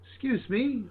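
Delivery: calling for attention. Acted. Adventurer drawing a creature away from the party, calling. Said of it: "trying to bring the attention more to myself".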